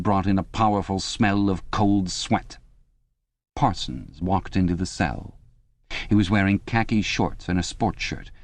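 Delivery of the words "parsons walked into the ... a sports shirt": usual speaking voice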